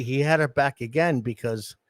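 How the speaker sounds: usual speaking voice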